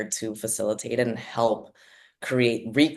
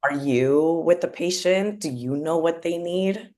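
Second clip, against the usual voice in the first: higher-pitched, incredulous questioning; a touch of sarcastic indignation; mock indignant challenge; 6 semitones higher, 4 dB louder than usual. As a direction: challenge them with mock politeness. Acted challenge to insurance companies.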